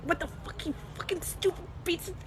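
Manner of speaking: high, hushed inner rant; suppressed mock fury; mock super angry. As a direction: seethe under your breath, acting out your judgy inner voice. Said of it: "I get super angry".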